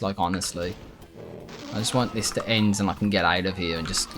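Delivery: normal talking voice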